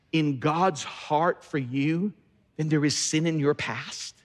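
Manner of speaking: slow it right down on God's heart; slow and deliberate on "in God's heart for you"; slow emphasis. Shown as a demonstration. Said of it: "slowed for emphasis".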